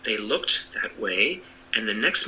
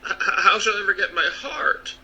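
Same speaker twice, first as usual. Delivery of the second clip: higher, stammering worry; distressed stammer; 9 semitones higher than usual. Acted. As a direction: stumble over the start in dismay. Tin Woodman stammering in distress.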